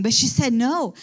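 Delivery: ordinary talk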